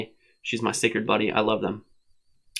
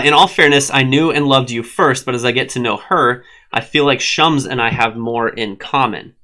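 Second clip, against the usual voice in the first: normal talking voice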